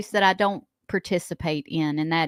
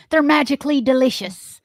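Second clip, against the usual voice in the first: laughing mock Irish leprechaun; giggly Irish leprechaun; 5 semitones higher, 6 dB louder than usual. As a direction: sell it like the cereal leprechaun. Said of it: "She's gonna do Lucky Charms one".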